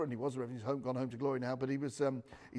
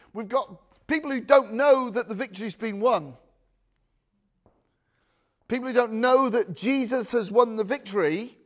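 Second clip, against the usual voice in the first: fire and brimstone preaching; 6 semitones higher, 8 dB louder than usual. Acted. Preacher to his congregation about spiritual war, loud and driving. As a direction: thunder the victory of Jesus over the room.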